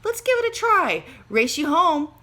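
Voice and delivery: high, loud coaxing; excited challenge, loud and high; eager. Acted. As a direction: dare him to race you. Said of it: "protested Judy".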